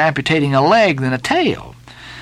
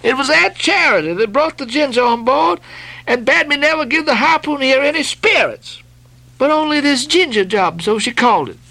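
high, fretful pleading; timid steward; 12 semitones higher than usual. Acted. Doughboy blaming Aunt Charity to Stubb, high fretful plea. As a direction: whine out the excuse.

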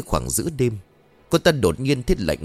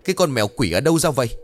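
high, panicked shouting orders; high frantic screech at the stepdaughter; shrieking in pain; 7 semitones higher, faster than usual. Acted. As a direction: yell out, clawed and stinging. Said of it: "vừa hét vừa chu chéo lên".

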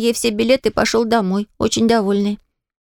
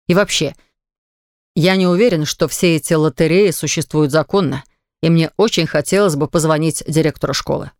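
a low, grumbling father, irritated; a hint of a cross dad holding forth; lightly voiced stern father; 6 semitones lower than usual. A narrator's reading. Father complaining to mother about school lotteries, low and irritated.

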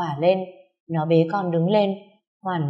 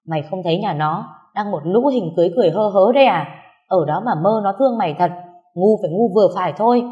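louder, faster, scolding rant; loud exasperated berating; 5 dB louder than usual. Acted. Older friend berating the young mother, loud and fast. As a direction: tear into her for being so gullible.

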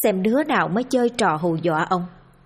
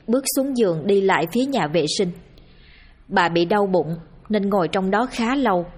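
ordinary delivery

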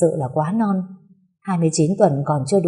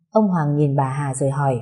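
ordinary delivery, nothing marked

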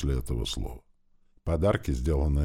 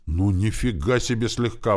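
raised, mildly outraged disbelief; indignant; 5 semitones higher, 4 dB louder than usual. A narrator's reading. Zert to boy who punched him, indignant. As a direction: balk at the understatement. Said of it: "возмутился Зерт".

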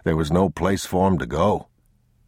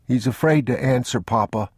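put-on higher-pitched small boy voice; worried child; 6 semitones higher than usual. Boy explains to Papa why nobody answers, worried. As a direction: say it as an anxious boy.

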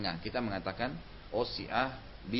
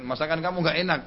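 louder, higher, an attacking husband; mock harsh criticism; 6 semitones higher, 6 dB louder, faster than usual. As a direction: snap it at the cook. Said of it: "diserang oleh suaminya".

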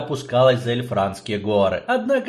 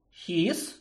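higher, soft astonished question; surprised; 6 semitones higher, 7 dB quieter than usual. Acted. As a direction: gasp the name in disbelief. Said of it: "изумилась Эльтара".

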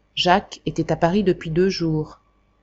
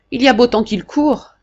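sly porter; 6 semitones higher, 4 dB louder than usual. Acted. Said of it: "dit-il sournoisement".

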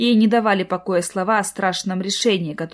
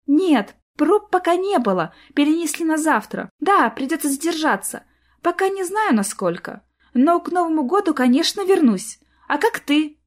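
lovestruck ditzy voice; 7 semitones higher than usual. Acted. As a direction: play the sweet silly girlfriend on the phone. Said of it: "голосом влюблённой дурочки".

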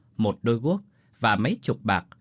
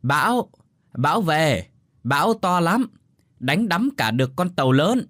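higher, louder storm warning; insistent raised-voice alarm; shouting loudly; 7 semitones higher, 5 dB louder than usual. A narrator's reading. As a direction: shout it to someone hard of hearing. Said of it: "Bà Tư lại hét lớn".

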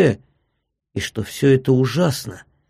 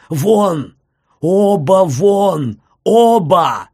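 loud, higher, drawn-out shouts; enraged shout; 5 semitones higher, 5 dB louder, much slower than usual. A narrator's reading. Doctor ordering both visitors out, shouting in a rage. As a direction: throw each word out like an order. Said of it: "крикнул он не своим голосом, багровея и дрожа всем телом".

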